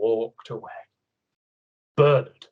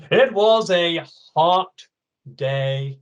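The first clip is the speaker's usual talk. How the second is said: dramatic storytelling; 3 semitones higher, 5 dB louder, slower than usual. A narrator's reading. Story narration.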